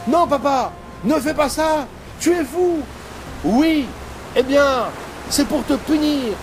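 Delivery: high, loud quarrel voices; hammed-up father-son shouting match; staged shouting quarrel. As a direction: fake quarrel for the watching sailors.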